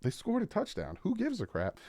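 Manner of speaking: high, quick mock shrug-off; imitating Tua. Quarterback brushing off his receiver's mistake, high quick mock voice. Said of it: "but Tua was like".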